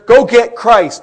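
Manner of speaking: shouted order from the chief priests; commanding order. Acted. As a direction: send the officers off forcefully.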